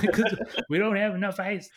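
loud laugh through words. Real, not acted. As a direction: crack up mid-sentence. Laughing at own story.

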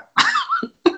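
high giggle burst; a short shrieking laugh; squeaky laugh. Real, not acted.

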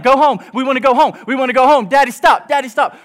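high, urgent mock wailing; imitating screaming toddlers. Two-year-olds begging dad to leave the pool, mock screaming. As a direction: plead like panicked little kids, acted. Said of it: "They were crying and they were screaming".

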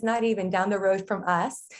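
normal talking voice

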